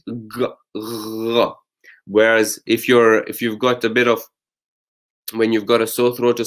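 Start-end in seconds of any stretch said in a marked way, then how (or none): 0.1-1.5 "Gha" voiced and open, a soft gargle; open-gate gha. Shown as a demonstration. Retelling Salma auntie's tip, showing gha as an open gate. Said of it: "but you open the gate".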